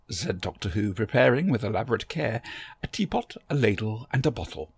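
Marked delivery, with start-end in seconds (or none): none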